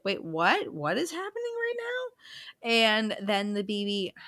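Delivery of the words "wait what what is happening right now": sudden high-pitched disbelief; shocked squeak of confusion; shocked Lionel. Retold or imitated. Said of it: "Lionel's like".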